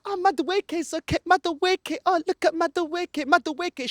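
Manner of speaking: a hint of a gushing TV pundit; mocking pundit gush. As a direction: gush over Madueke like a smug pundit. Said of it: "that puta".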